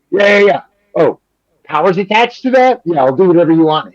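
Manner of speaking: lightly voiced politician agreeing with a leader; eager Schumer. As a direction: rattle it off like a man grabbing at power.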